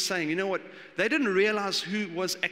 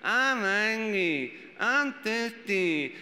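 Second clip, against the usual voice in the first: put-on very slow, drawn-out childish whine; whiny little kid; 3 semitones higher, much slower than usual. Parent imitating their whiny kid on a trip, very slow. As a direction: whine like a bored five-year-old in the car. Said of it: "when your kid's like".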